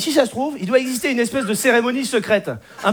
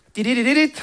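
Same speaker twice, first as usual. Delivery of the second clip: rapid chirped computer notification; imitated chat alert; faster than usual. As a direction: mimic a little electronic ringtone. Imitates online chat alert sound.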